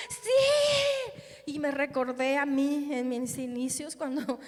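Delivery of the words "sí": put-on children's happy yes. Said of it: "y los niños bien, bien contentos".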